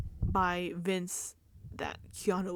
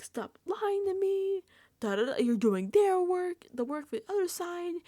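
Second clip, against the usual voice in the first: mock angry yelling; 10 semitones higher, 3 dB louder than usual. Imitating Keanu yelling at Vince, mock angry. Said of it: "He's, like, yelling at Vince".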